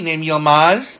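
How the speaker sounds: ordinary talk, nothing marked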